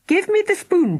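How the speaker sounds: high, loud demand; girl demanding the spoon, loud and high. Acted. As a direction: insist on the spoon. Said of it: "demanded Philly".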